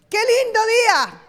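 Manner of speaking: high, loud fake delight; fake cheer. Shown as a demonstration. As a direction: gush over the rainy day. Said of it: "Estaba lloviendo".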